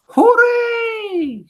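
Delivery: very high, loud, long cheer; piercing witch hooray; witches' shout. A narrator's reading. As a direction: shriek it in wicked triumph. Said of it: "all us witches shout".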